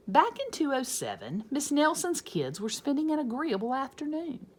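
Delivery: usual speaking voice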